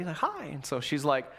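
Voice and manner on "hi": put-on friendly little kid. Cute little kid greeting a stranger, imitated friendliness. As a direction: imitate a friendly small girl. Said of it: "just real friendly".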